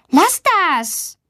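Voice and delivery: high, indignant scolding. A narrator's reading. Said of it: "Luisa schimpft". (schimpfend, mit hoher Stimme)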